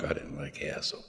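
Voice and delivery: murmured aside repeating "hassle"; hushed emphatic repeat. Real, not acted. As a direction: drop "I didn't like hassle" down low and quiet.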